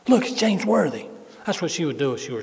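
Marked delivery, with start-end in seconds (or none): 0.1-1.1 high, loud star-spotting cry; loud, excited imitation; excited pointing out. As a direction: mimic a wife blurting out a celebrity sighting. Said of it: "and there she goes".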